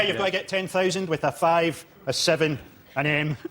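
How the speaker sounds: low, half-hearted letter picking. Acted. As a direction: drop it low, utterly uninterested. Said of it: "couldn't care less, half-hearted way".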